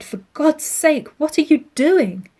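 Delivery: high, loud, alarmed cry; husband cries out in shock at his wife; cried out. A narrator's reading. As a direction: shout in horror at the door. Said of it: "he cried".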